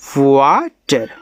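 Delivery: Water stretched out very slowly, each sound drawn long; slow demo word. Shown as a demonstration. Teacher showing students how to say water, very slow. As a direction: model water slowly for the class to copy. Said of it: "ऐसे".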